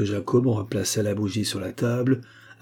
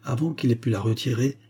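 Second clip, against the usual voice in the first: usual speaking voice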